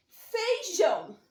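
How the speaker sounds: teacher dictating Feijão slowly to pupils; slowly stressed Feijão. Shown as a demonstration. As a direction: say Feijão slowly, stretching the final ão. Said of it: "Olha o som".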